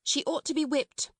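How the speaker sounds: high, indignant pronouncement; stern proclamation. A narrator's reading. Miss Minchin over the howling child, stern proclaiming. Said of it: "Miss Minchin proclaimed".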